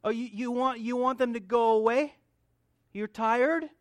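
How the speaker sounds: Jesus ribbing his disciples; teasing Jesus. Retold or imitated. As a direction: poke fun at the tired disciples. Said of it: "Jesus is a joker".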